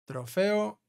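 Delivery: usual speaking voice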